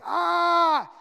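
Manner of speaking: high, loud shout of rage; acting out the crowd's outraged shout; loud cry. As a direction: let out the crowd's outraged yell. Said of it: "they cried out with a loud voice".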